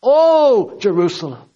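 preacher's impassioned lament, swelling on the first word; yearning cry. Retold or imitated. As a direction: cry out in longing, falling away. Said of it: "He yearns over you".

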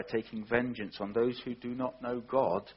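usual speaking voice

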